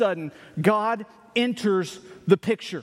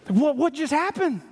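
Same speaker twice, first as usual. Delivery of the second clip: surprised; 8 semitones higher than usual. Acted. Preacher's mock astonishment.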